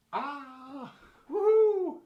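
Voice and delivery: high, excited squeal at the dog; excited pet talk. Real, not acted. Playing with the dog.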